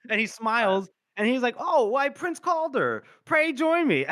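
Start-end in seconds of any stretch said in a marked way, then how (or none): 1.6-4.1 high, loud mock-delighted welcome; pleasantly surprised greeting. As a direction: greet him as if by happy chance. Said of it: "as if they just ran into each other".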